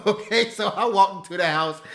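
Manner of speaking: laughing through the words; amused, laughing retelling. Real, not acted. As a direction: chuckle as you retell it.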